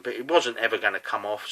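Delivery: usual speaking voice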